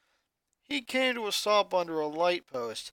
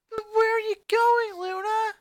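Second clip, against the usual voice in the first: put-on little girl voice; 5 dB louder than usual. Little sister asks worried.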